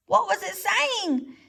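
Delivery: high, slow groundhog voice; a timid groundhog asks. A narrator's reading. Groundhog asks the owl, high slow voice. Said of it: "said Dig Down the groundhog".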